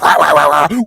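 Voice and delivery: playful doggy babble; baby talk to a dog. Retold or imitated. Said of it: "just talking like that".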